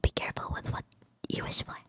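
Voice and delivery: soft ASMR whisper close to the mic. Real, not acted. Streamer trying out ASMR for viewers, whispering. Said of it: "if I'm whispering really close to the mic".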